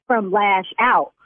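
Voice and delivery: ordinary delivery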